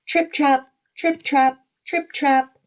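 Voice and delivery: high, crisp trip-trap; hoofsteps. Acted. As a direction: sound out trotting hooves in a high voice. Second goat crossing, high hoofsteps.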